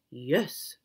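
low, soft owl voice; low hushed owl. Acted. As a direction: answer like a calm old owl. Owl speaks.

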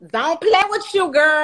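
high, loud mocking echo; mock outrage. Friend answering "don't play with me", loud teasing mimicry.

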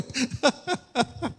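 a short, high burst of laughter. Real, not acted. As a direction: laugh it off lightly. Laugh alone after "tội lỗi.

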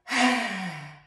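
loud breathy roaring exhale; lion's breath exhale. Shown as a demonstration. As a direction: blast the breath out like a lion. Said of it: "Stick your thumbs up and".

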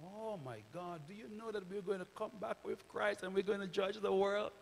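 usual speaking voice

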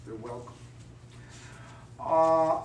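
normal talking voice

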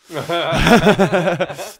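loud bright burst of laughter. Real, not acted. Laughs after taking words back.

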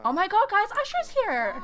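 thrilled fan screaming to friends; excited squeal. Acted. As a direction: shriek it like you've spotted a celebrity. Star spotted, excited.

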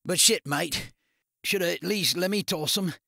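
wiry Australian crook, mocking; Aussie tough guy needling his mate; rough Aussie thug voice. Darren complaining.

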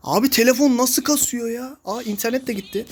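ordinary talk

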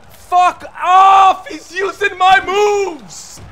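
very loud, high drawn-out yell; real gamer rage, words stretched out; furious outburst. Real, not acted. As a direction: scream at the opponent in outrage. Raging at opponent in game.

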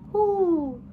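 high, loud hooting owl. A narrator's reading. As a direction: call out like an owl in the dusk. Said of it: "hoot of the owl".

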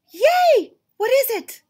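high, loud thrilled cheer; excited cheer. Acted. Gerald hears he has a surprise, high loud cheer. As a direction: burst out with delight.

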